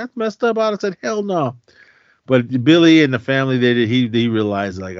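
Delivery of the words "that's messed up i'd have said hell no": put-on kid self. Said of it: "I was like".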